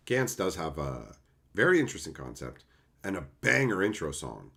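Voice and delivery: usual speaking voice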